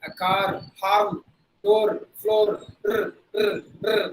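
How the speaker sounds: "car, horn, door, floor" with tapped R, then "r" trilled; Indian-English R. Shown as a demonstration. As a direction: sound every R with the tongue tip. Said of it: "That is how we pronounce the letter R". (Tamil ர் ஒலி: "car", "door", "r")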